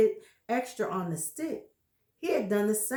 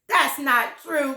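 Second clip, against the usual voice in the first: higher, louder protesting wife; Missus Twit crying out; 5 semitones higher, 7 dB louder than usual. Acted. Wife denying she shrinks, a higher cry. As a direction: cry out in denial. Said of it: "cried Missus Twit".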